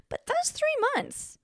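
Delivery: but that's three months, said way up and slow; emphatic high. Real, not acted. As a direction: stretch it out, pitched high for shock. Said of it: "emphatic pitch jump".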